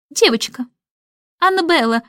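brief, matter-of-fact nanny answer; understated curtness; curt reply. A narrator's reading. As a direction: answer shortly, in separated words. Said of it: "кратко отозвалась Мэри Поппинс".

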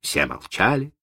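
usual speaking voice

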